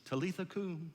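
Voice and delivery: put-on soft, gentle mother waking her child; sweet wake-up call. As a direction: coax a little girl awake, tenderly. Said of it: "say to her sweetly".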